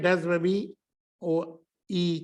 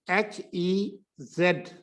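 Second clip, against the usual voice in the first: normal talking voice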